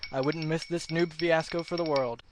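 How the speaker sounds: ordinary talk, nothing marked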